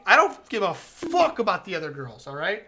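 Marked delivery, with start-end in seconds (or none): none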